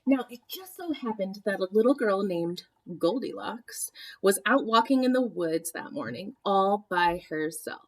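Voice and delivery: usual speaking voice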